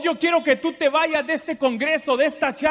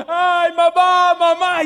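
put-on child's nightmare shout, louder and higher; crying out for mom; 6 semitones higher, 6 dB louder, slower than usual. Boy calling his mother after a bad dream, loud frightened shout.

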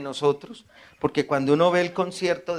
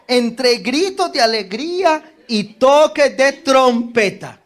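joyful reading; 8 semitones higher, 7 dB louder than usual. Real, not acted. Reads psalm with joy.

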